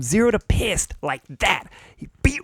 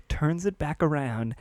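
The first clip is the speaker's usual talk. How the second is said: ordinary talk, nothing marked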